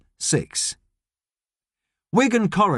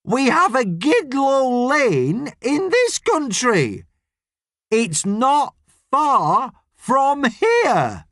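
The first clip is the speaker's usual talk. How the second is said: high, drawn-out shouting as if to a foreigner; very slow, over-enunciated holler; slow yell; 10 semitones higher, much slower than usual. A narrator's reading. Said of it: "yells Gladys slowly".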